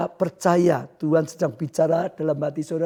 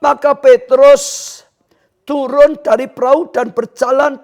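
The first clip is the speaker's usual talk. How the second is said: loud, high, deliberate preaching; forceful scripture declamation; 8 semitones higher, 6 dB louder than usual. Real, not acted. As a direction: proclaim the story at full force. (membaca firman dengan lantang)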